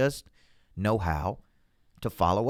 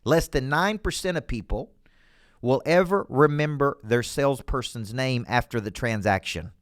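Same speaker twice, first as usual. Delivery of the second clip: ordinary talk, nothing marked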